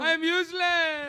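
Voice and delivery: slow, hammed-up self-pitying cry; mock a believer moaning at home; drawn-out mock wail; acted lament. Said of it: "when you go home and cry".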